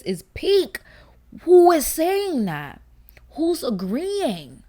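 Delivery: slow, drawn-out incredulous demand; mock outrage. Challenging fans who call Sakamoto Days peak, mock outrage.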